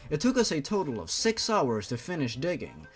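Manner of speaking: normal talking voice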